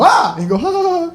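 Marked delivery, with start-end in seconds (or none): 0.0-0.3 startled yell. Retold or imitated. 0.6-1.1 high relieved laughter. Retold or imitated. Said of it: "'cause you're so relieved".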